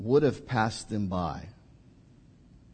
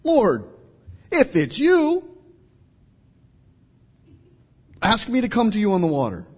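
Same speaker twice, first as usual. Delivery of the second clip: high, loud eager plea; eager Peter; 9 semitones higher, 5 dB louder than usual. Retold or imitated. Peter asking Jesus to call him, high and eager. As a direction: Peter begging to join, pitched high. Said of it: "Peter goes".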